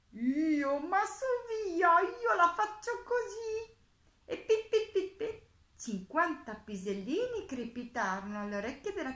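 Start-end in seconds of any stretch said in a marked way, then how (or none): 0.1-3.8 high, loud, whiny little hare; squealing storybook hare. A narrator's reading. Hare answers the mole in story. 4.3-5.5 high, loud staccato pips; squeaky storybook sound effect; tiny pattering noises. A narrator's reading. Narrator imitates tiny droppings falling.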